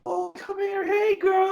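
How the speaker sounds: very high, cooing at the dog; gushing dog lovers. Retold or imitated. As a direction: coo like a stranger charmed by a husky. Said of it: "people be talking to me".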